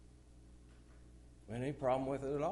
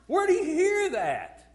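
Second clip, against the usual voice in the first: high, loud, slowed where did he hear that; shocked loud question; 12 dB louder, slower than usual. Real, not acted. Preacher confronting the congregation, loud indignant question. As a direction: hammer the question home.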